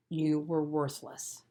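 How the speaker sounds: plain reading voice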